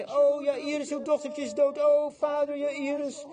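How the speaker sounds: high, keening lament of mourners; wailing mourners. Retold or imitated. Mourners wail over the dead girl to her father, high lament.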